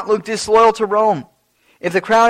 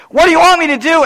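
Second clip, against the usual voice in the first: higher, louder exasperated plea; Pilate pleading; 10 semitones higher, 8 dB louder, faster than usual. Retold or imitated. Pilate pleads with the crowd, high and loud. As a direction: beg the crowd for an answer. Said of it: "Pilate asked over and over again".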